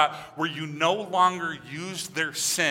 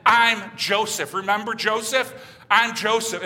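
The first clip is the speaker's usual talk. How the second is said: loud, higher-pitched announcement, first words drawn out; dramatic reveal; 5 semitones higher, 4 dB louder than usual. Acted. Joseph revealing himself to terrified brothers, dramatic reveal. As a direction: announce yourself with force, as a stunning revelation.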